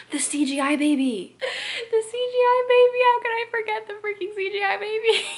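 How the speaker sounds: high, loud incredulous exclamation; real outburst. Real, not acted. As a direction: exclaim it loudly as the memory hits. Sudden horrified memory of movie baby.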